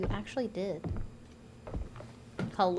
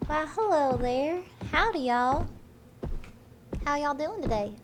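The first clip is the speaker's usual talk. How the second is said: sweet Southern accent. Shown as a demonstration. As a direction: greet folks in a put-on sweet Southern accent. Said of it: "nice southern accent".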